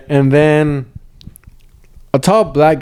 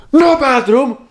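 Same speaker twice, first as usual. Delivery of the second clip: much higher, curt put-on accent; mock Colombian mechanic; 10 semitones higher, much slower than usual. Mechanic shooing the stranger away, high curt mock accent. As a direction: shoo him off in broken English.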